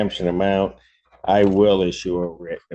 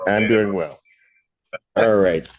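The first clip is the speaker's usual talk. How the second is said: ordinary delivery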